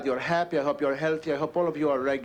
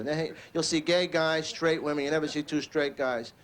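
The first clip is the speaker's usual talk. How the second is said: ordinary delivery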